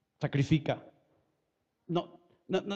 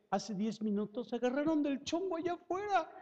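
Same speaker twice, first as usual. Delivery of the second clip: higher-pitched, climbing tattletale aside; tattling Holy Spirit; 6 semitones higher, 4 dB quieter than usual. Acted. Holy Spirit telling on quarrelers outside, higher tattling voice. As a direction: report it high, without raising volume. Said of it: "llega el Espíritu Santo y dice".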